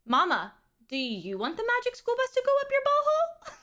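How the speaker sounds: put-on high, giggly toddler voice laughing through the question; a mom imitating her toddler's sincere, silly question in a high voice; little son's voice. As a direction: squeak it out like an excited little kid, almost laughing. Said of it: "He's like".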